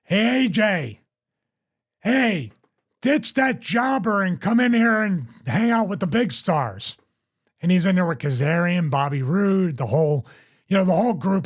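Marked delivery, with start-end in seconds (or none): 0.0-7.0 retold drunken yell. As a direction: call across the bar like a drunk heckler, half-hearted. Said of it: "He screams to A J".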